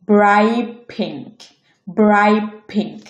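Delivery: ব্রাইট said "braip" before pink; assimilated T. Shown as a demonstration. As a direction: turn the t ending ব্রাইট into an unreleased p. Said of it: "same নিয়ম".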